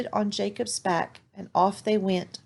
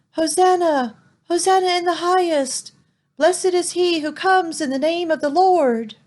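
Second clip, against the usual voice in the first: high, loud festive acclaim; crowd shouting; 8 semitones higher, 5 dB louder than usual. Acted. Crowd on the road hailing Jesus, loud joyful shouts. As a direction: hail him like a cheering crowd. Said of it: "people shouting things like".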